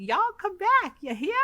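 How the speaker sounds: high squeaky raccoon drawl. Retold or imitated. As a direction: chirp it up high like a tiny cowboy raccoon. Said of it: "So it's the".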